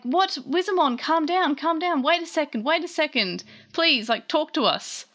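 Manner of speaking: high-pitched mock panic, calming someone; urgent placating. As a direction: plead for calm as a retold character. Retold attempt to calm Wizamon.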